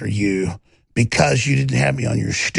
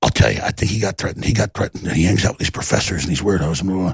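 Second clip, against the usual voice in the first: imitating Eddie Bravo; 8 semitones lower, faster than usual. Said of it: "was like".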